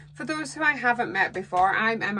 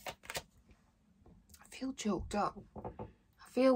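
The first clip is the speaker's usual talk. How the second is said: quiet, choked-up voice; 3 semitones higher, 7 dB quieter, slower than usual. Real, not acted. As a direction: speak past a lump in the throat. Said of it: "I've got a lump in my throat".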